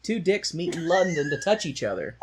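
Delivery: high, loud mock delight; hammed-up sarcastic cheer; mocking sarcasm. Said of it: "I was like".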